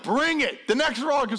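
high, pumped-up challenge; mock daredevil little man; fearless kid. Son now fearless.

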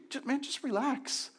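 high, soft mocking "just relax"; imitating a friend telling him to relax; dismissive advice. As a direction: mimic the careless reply. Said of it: "someone just tells you".

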